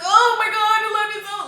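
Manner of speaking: high, loud falsetto mock moaning; shrieking mock lover voice. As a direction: ham up his over-the-top moaning. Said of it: "He's like".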